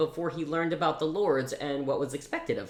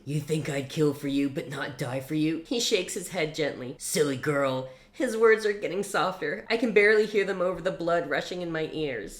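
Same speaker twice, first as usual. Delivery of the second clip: emotional read-aloud of a novel scene; dramatic story reading; 3 semitones higher than usual. Acted. Reviewer reads the book's climax aloud dramatically.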